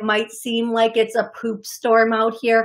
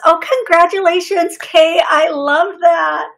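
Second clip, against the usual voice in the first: higher, much louder burst of joy; excited congratulations; 7 semitones higher, 7 dB louder than usual. Real, not acted. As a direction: cheer for her good news. Congratulating a caller's good news.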